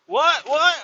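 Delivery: high, loud mocking imitation; mimicked confusion. Kid failing to understand the order, mocked as baffled. Mimicking clueless kid's reply.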